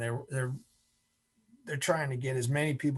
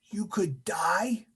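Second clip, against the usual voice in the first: slow, higher, with a questioning rise; doc's drawn-out repeat; 5 semitones higher, slower than usual. Retold or imitated. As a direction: stretch it out for the whole class. Doc repeats it for the class.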